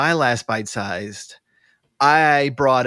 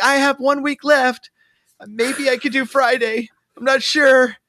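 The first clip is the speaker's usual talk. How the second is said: high, pleading imitation; teasing impression of someone unsure; mock hesitant friend; 12 semitones higher, 4 dB louder than usual. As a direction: waver in a put-on high voice.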